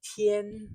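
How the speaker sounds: Tian high and steady; first tone. Shown as a demonstration. Teacher's language note on Tian, high steady tone. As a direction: keep Tian high and level. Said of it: "high and steady".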